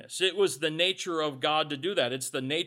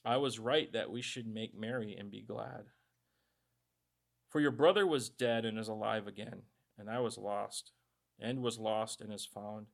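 soft, low, reassuring father's reply; gentle father; 5 semitones lower, 5 dB quieter than usual. Acted. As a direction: soothe the jealous son, softly. Father's words re-read gently.

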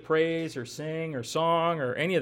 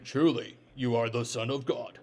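put-on a light John Wayne cowboy accent, lower; 7 semitones lower, 4 dB quieter than usual. Disciples in the boat hailing Jesus, a lightly done John Wayne accent. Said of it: "you gotta say it in a John Wayne accent".

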